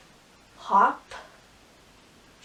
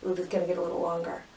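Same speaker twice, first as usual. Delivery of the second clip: normal talking voice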